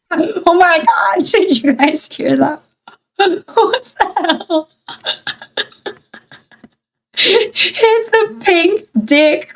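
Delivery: high, slow, laughing through it; giggling in shock. Real, not acted. Laughing at voice changer effect.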